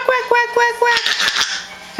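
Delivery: put-on high quacks for a toddler; duck quacking imitation. Shown as a demonstration. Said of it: "Can you say quack".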